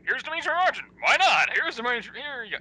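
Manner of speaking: high, loud offhand announcer; mock casting call. Host mocks random movie casting in a high, loud announcer voice. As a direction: toss the name out like a careless casting director.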